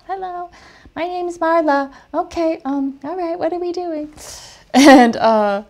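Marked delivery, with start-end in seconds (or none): 0.1-4.1 put-on high, small childlike voice; little kid voice. Marla introducing herself on set, small childlike voice. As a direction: mimic a timid grown woman sounding like a child. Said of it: "little kid voice".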